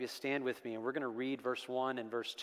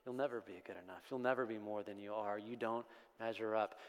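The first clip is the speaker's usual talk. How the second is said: soft, lower, hushed accusation; accusing whisper; 5 semitones lower, 7 dB quieter than usual. Acted. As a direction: hiss it softly like an enemy's voice. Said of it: "a whispering from an enemy".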